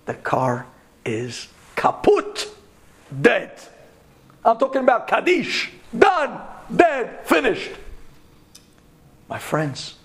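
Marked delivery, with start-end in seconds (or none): 1.7-7.7 emphatic finality. Real, not acted. Emphatic declaration car is dead.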